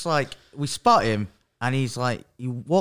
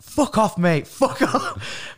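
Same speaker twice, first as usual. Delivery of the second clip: mock angry yell; 9 semitones higher, much slower than usual. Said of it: "Rob just was like".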